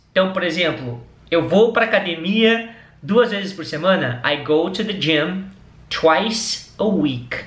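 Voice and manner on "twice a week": slow and clear, each word apart; slow model phrase. Shown as a demonstration. Teacher models a phrase for students, slow and clear. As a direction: spell it out slowly for the learners. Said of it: "repete comigo".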